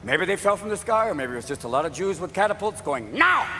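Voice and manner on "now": sudden high yell to fire; shouted command. Acted. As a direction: bark one word as a launch order. Catapult crews given firing command.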